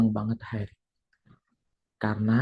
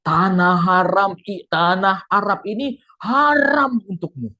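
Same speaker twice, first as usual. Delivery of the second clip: slow, loud menacing shout; ghostly crowd shouting the curse; booming voice; 5 semitones higher, 5 dB louder, slower than usual. Retold or imitated. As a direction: boom the curse like a crowd of spirits. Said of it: "dengan perkataan yang sama".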